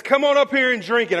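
yelled high and loud in mock rage; angry challenge. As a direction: hurl it at the crowd like a dare. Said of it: "I mean, he's angry".

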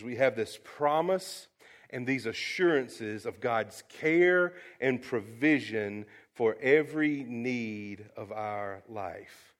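ordinary delivery

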